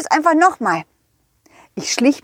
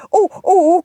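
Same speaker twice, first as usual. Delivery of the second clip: startled oh; 7 semitones higher, 4 dB louder than usual. Acted. Alarmed at the nose.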